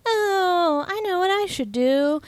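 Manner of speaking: high, drawn-out dreamy "oh"; mock sweet girlish realization; Sandy's bright idea. Voicing the heroine off in the distance getting an idea, mock dreamy.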